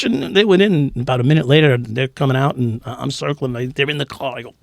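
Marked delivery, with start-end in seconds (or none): none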